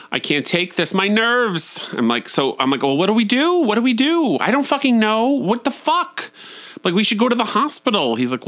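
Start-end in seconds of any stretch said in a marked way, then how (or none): 0.1-1.9 high, rising mock hysteria; overwrought, pitch climbing on the nerves; frazzled panic. Partner retelling the fall while holding Jackson, frazzled. 2.8-8.1 high-pitched comic hysteria; panicked dithering. Retold or imitated. Speaker reacting to partner's broken ankle, imitated panic.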